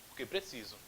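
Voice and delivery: porque clipped and soft; fast, quiet porque; quick answer. Shown as a demonstration. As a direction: rattle off the answer. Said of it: "um porquê que sai mais rapidinho".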